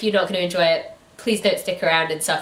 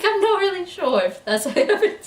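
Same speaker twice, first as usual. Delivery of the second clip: words through real laughter, higher; laughing; 13 semitones higher than usual. Real, not acted. Co-host laughs in disbelief at the plot, very high. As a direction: laugh while you say it.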